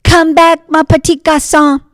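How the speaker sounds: high, loud calling after him; shouting. Acted. Marcel chases the beignet boy, loud high shout. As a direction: yell down the street after the runaway.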